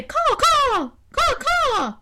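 very high loud caws; crow cawing. Acted. Crow shows the bear how it nurses, cawing high. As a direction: caw like a crow. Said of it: "cawed the crow".

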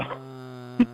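long, low zombie groan; low monotone undead groan; zombie movie groan. Acted. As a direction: drone a low, drawn-out movie-monster moan. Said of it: "that was me quoting from the movie".

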